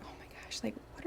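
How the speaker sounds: shocked whisper to herself; hushed, stunned whisper. Retold or imitated. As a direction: breathe it out in disbelief. Shocked aside about nanny pay.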